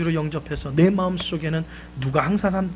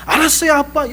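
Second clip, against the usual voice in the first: put-on higher, bright child's reply; eager son; 10 semitones higher, 6 dB louder than usual. Son answering his father, lightly voiced child. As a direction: answer like a willing boy.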